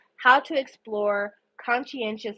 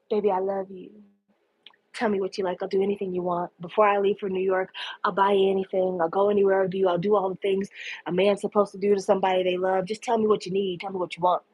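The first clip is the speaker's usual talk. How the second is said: low, even seductive murmur; sweet-talking lover; 6 semitones lower, faster than usual. Retold or imitated. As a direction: croon promises in a low, level voice. Boston sweet-talks Nick while slow dancing.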